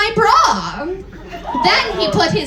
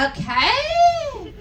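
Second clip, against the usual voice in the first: mock whiny okay; 11 semitones higher than usual. Mocking a fan comment.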